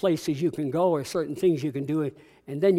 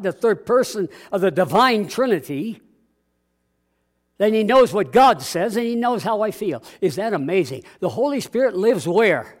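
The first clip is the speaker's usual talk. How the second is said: preaching; 5 dB louder than usual. Acted. Preaching to congregation.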